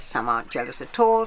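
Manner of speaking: usual speaking voice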